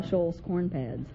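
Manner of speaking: ordinary delivery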